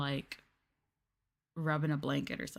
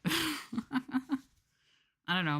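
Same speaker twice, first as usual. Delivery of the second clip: laughing; 7 semitones higher, much slower than usual. Real, not acted. Giggly drawn-out shrug.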